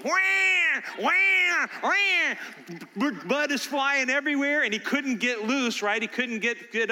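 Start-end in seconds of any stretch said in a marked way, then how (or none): 0.0-2.3 high, loud exaggerated crying wail; mock wailing. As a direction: wail like a stuck toddler. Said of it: "he's going".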